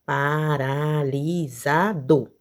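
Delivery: "Paralisado" drawn out slowly, pa-ra-li-sa-do; syllable by syllable. Shown as a demonstration. Teacher counting syllables for pupils, slow syllable-by-syllable "Paralisado". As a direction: stretch each syllable of "Paralisado". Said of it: "abra a boca pra pronunciar".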